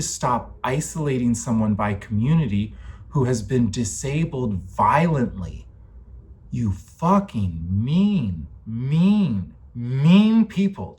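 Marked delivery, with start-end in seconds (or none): 6.5-11.0 building anger. Real, not acted. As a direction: spit out each mean, rising to a yell. Raging at people who hurt her.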